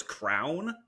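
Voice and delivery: "crown" as a version of crayon; "crown", one syllable with an "ow" vowel; odd pronunciation of crayon. Shown as a demonstration. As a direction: cite crayon mispronounced as "crown". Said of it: "one of the craziest pronounce".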